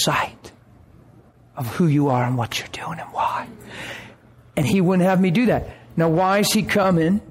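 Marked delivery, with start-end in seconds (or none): 0.0-3.5 hushed, intimate whisper. Acted. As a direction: whisper the point home. God's quiet final words.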